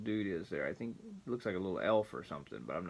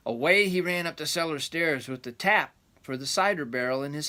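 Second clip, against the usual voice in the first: rambling grandpa story; 4 semitones higher, 3 dB louder than usual. A narrator's reading. Reading the folk tale aloud.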